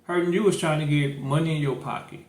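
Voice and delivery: ordinary talk, nothing marked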